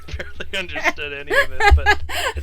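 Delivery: laughing, loud and high. Real, not acted. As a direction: laugh through the words. Player laughs at negotiation.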